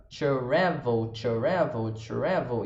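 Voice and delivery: "travel" said as tcha-ra-vel, a vowel slipped between t and r; travel with a tcha sound. Shown as a demonstration. Teacher showing the first step of the drill, slowly. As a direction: stretch "travel" into tchara-vel, then shorten. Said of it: "Você vai pensar num tcha".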